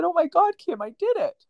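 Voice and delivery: retold overjoyed cry of a proud winner; excited squeal. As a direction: burst out like she just won. Winner retells her joyful shout.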